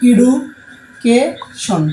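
Education in three separate chunks; slow, counted-out syllables of education; split into syllables. Shown as a demonstration. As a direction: pause after এডু and after কে in education. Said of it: "এই যে তিনটা আলাদা আলাদা".

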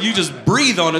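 normal talking voice

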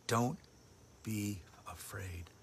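slow, unvoiced whisper of comfort; whispered reassurance. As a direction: breathe the words softly like a comfort. Repeats words in whisper.

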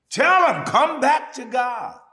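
loud, high and slow plea; preaching at full force. Acted. Preacher urging listeners back to God, loud and slow. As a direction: call them home.

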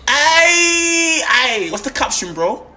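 sudden high shout across the room; calling out. Acted. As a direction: yell "Ay" to grab his attention. Calling out to a friend.

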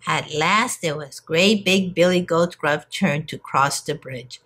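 usual speaking voice

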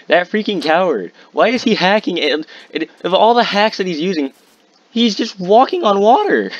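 high-pitched, incredulous complaint at a cheater; exasperated outrage. Real, not acted. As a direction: voice shooting way up in disbelief. Outraged at a hacking opponent.

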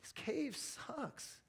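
soft, very drawn-out complaint; venting honestly. Acted. Preacher voicing an honest complaint to God, slow and soft. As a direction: let the grievance out slowly and quietly. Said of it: "in the moment, to just say".

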